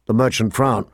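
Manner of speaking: plain reading voice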